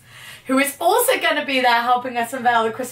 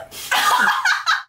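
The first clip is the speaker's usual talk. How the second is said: squealing laugh at her own pun; high burst of laughter. Real, not acted. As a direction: giggle at the joke.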